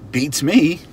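loud, high, puzzled exclamation; baffled beats me. Shown as a demonstration. Shrugging answer in example.

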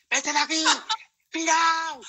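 high, drawn-out mock shout of an inner voice; head yelling insults. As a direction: voice the nagging mind, shrill and mocking. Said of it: "mi cabeza me insulta, pero a grito pelado".

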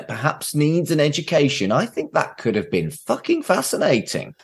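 usual speaking voice

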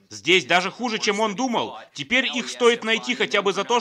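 normal talking voice

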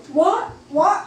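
high, rising shocked questions; flipping out. Retold or imitated. Doctors seeing the girl revive, retold flipping-out disbelief. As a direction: imitate stunned doctors, voice shooting up. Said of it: "they was flipping out".

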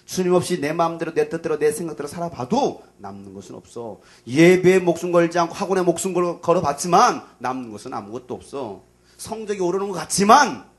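fiery preacher warning students; full-force preaching. Acted. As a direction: drive the warning home hard. Loud preaching exhortation.

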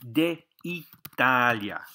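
De Italia drawn out in syllables; De Italia stretched out for learners; slow phrase model. Shown as a demonstration. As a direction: say De, I-ta-lia slowly. Teacher models the phrase slowly.

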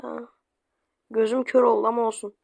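usual speaking voice

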